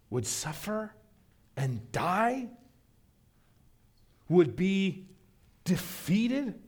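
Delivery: high, rising questions full of disbelief; how could this be, said in shock; high incredulous pleading. Acted. Voicing disciples' disbelief.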